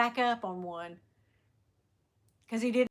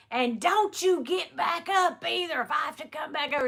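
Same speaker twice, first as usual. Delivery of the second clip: stern cop; 5 semitones higher than usual. Retold or imitated. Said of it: "that cop said".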